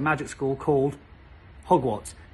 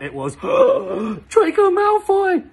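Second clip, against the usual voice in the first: much higher, slow, breathless gasp; squealing mock swoon; gasping reveal; 6 dB louder, much slower than usual. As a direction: gasp as if stunned.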